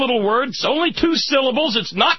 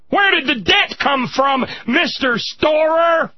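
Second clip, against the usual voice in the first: angry shout; 5 semitones higher than usual. Real, not acted. Host yelling at the veteran over the debt, angry. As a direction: demand an answer.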